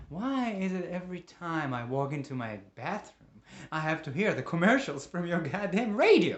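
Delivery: loud exasperation, climbing to the end; angry complaint. Acted. Man fed up with neighbor's radio, angry complaint.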